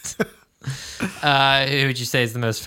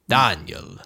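Daniel as Dahn-yel in a lower mock count voice; vampire accent; 6 semitones lower than usual. Host repeating the name in the vampire accent. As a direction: roll the name out like a Transylvanian count. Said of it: "you can't do Daniel in that accent".

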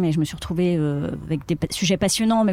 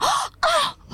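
high, breathy-free squeal of awe at her man; mock-adoring girlfriend gasp; gushing admiration. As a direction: coo over him like he's a hero. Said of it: "montrer à son homme qu'il est le plus fort".

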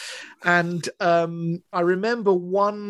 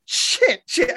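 panicked thought. Retold or imitated. Panicked thought running away.

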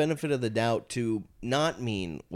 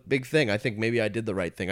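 ordinary talk, nothing marked

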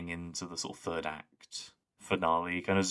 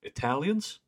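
"Italians" pitched up as a rising question; questioning delivery; 8 semitones higher, slower than usual. Retold or imitated. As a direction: imitate a puzzled "Italians?", lifting high at the end. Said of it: "It's just the way it's like".

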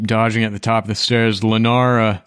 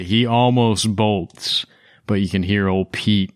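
usual speaking voice

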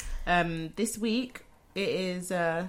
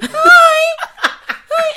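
mock squeaky high greeting; a sweet, very high little hello; high public voice; 15 dB louder than usual. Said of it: "when you're in public, you're like".

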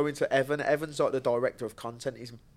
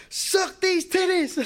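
high, loud, drawn-out holler; re-enacted shout; 7 dB louder, much slower than usual. Fighter shouting to the weigh-in crowd, full-volume high yell. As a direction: belt it out like a showman's reveal.

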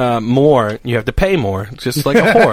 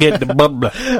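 laughing New England bubbla; mock the New England accent; imitating New England accent; slower than usual. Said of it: "You sounded like Dom saying bubbler".

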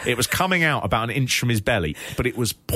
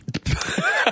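laugh. Real, not acted. Laughing at own joke.

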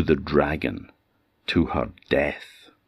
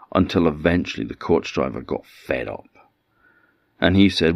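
ordinary delivery

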